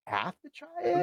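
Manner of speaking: high, breaking disbelief; can't-believe-it squeak; incredulous. Real, not acted. Incredulous question to chef.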